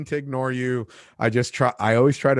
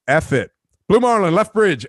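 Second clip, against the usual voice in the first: loud, higher, fed-up yell of the callout; fed-up shout; 7 semitones higher, 8 dB louder than usual. Retold or imitated. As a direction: give up being polite and yell it out. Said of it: "So I'm like".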